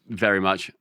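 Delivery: usual speaking voice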